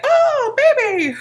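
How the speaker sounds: high, loud, drawn-out mock squeal; exaggerated cooing over a baby; mock gushing. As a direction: squeal it like an overexcited belly toucher. Mimics gushing belly toucher.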